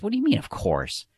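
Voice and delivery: furious. A narrator's reading. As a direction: throw his words back at him in disbelief. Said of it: "The man's furious by now".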